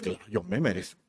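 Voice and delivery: low, soft aside to oneself; quiet self-satisfied thought; inner voice. Acted. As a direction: say it privately, under your voice. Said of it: "por dentro decimos".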